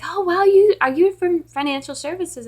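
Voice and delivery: high, loud mock amazement; gushing colleague imitation. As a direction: imitate a colleague wowed by the caller.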